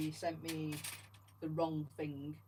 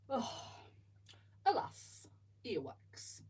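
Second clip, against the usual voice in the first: lightly voiced wise old wizard, lament on alas; a hint of Dumbledore tasting earwax; Dumbledore quote; 6 semitones higher, much slower than usual. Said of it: "Dumbledore quote".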